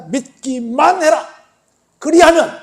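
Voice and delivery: 높고 크게, 느리게 끊어 외치기; 회중에게 힘껏 선포하듯. Retold or imitated. 강조하여 되풀이하는 말씀. (느리고 우렁차게 강조한 명령)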